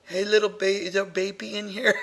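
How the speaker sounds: put-on soft cooing at a baby; mock baby talk. Investigator calling to a child spirit, soft mock baby talk. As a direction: gently parody baby talk, softly. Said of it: "talking baby talk".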